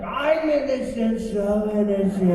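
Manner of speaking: slow, drawn-out mock plea of innocence; hammed-up villain protest, very slow; cartoon villain protesting.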